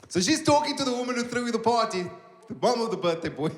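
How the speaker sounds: normal talking voice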